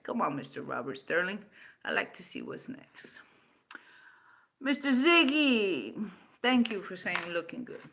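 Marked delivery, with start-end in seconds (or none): 1.8-3.2 unvoiced, low taunt; whispered aside. Real, not acted. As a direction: whisper the dare to yourself.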